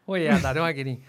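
normal talking voice

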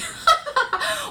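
shrill burst of laughter; 尖声大笑; high squealing laugh. Real, not acted.

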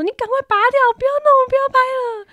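high, loud frantic orders; hammed-up panicked yelling. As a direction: shout at dad to stop filming. Yelling at dad to remove.

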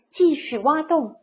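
usual speaking voice

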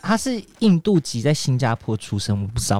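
usual speaking voice

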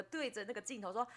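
normal talking voice

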